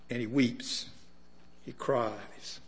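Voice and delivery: hushed. Acted. Preacher repeating that Jesus cried, hushed and low. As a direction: let it drop to a hush.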